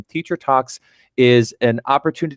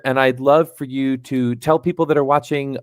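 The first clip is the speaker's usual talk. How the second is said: ordinary talk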